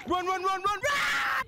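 high frantic shout; urgent shouting. Real, not acted. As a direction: yell at them to run. Urging teammate to flee.

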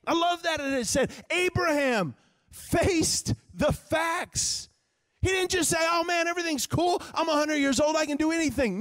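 high, emphatic preacher voice; full-force preaching. Real, not acted. Preacher retelling Abraham facing the facts, full-force preaching. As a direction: drive it home high, stretching the key words.

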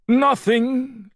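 high, too-quick denial; lying. A narrator's reading. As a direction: pretend all is fine while frightened. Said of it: "lied Bear".

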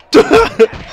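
high, loud burst of laughter. Real, not acted. Laughing about the kid in the crowd, loud burst of laughter. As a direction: let the held-in laugh explode. Finally lets out held laugh.

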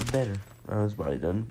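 ordinary talk, nothing marked

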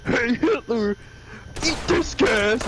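a hint of a cartoon champion; lightly voiced battle taunt. Mister Satan attacking Hitler, mock taunting.

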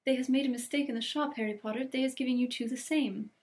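high, delighted elf voice. Acted. Elf thrilled by the old socks, delighted high voice. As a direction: gush happily like a house-elf.

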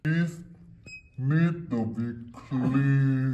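very slow, loud, each word stretched; slow emphatic. Acted. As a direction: spell it out slowly and firmly. Boss repeating, very slow.

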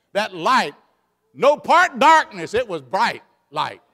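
loud, high shouted preaching; preaching at full force. Acted. Preacher proclaiming the skies cleared, loud and high. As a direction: ring it out triumphantly.

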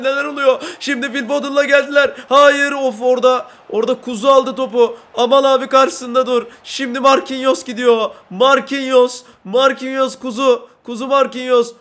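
building match call, loud at the goal; excited commentary. Real, not acted.

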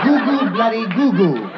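put-on slow British baby babble; British baby accent. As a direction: babble like a proper English baby.

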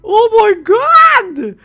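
high, loud, drawn-out cry; stunned gamer shout; shocked. Real, not acted. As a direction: wail it in astonishment. Shocked at the potion's price.

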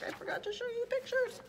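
very high, quick squeaky apology; sheepish aside. Real, not acted. Reader apologising to listeners about the pictures, squeaky sheepish aside. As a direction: rush it out in a tiny high voice.

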